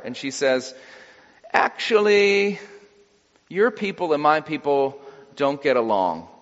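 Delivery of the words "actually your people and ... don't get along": hammed-up hedging before turning someone down; mock awkward refusal. Samaritan woman turning down Jesus's request for water, mock awkward.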